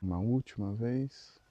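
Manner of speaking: slow, soft meditation voice with long pauses; guided meditation. Real, not acted. Leader guiding the group through breathing and neck stretches, guided meditation. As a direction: lead them gently through each breath, unhurried.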